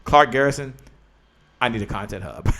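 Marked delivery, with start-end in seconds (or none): 1.6-2.5 quiet, laughing through the words. Real, not acted. Reading a viewer's request to Clark while laughing. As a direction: giggle it out under your breath. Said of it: "I'm, I'm laughing".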